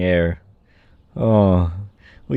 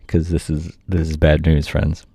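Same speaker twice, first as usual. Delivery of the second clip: ordinary delivery, nothing marked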